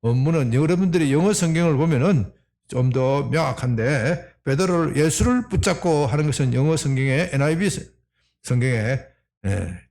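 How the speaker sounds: normal talking voice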